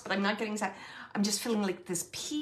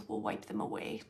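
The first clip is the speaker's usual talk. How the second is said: much lower, soft whispered repeat; tender whisper; 13 semitones lower, 7 dB quieter than usual. Retold or imitated. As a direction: whisper it as a gentle comfort. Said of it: "They're saying, like".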